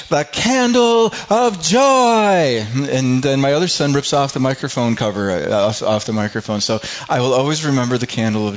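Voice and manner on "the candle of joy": high, drawn-out mock scream from a little boy; imitating child's scream. Little son at the church Advent reading microphone, imitated scream. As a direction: belt it out slowly like an over-eager kid, much higher. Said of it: "he screams out".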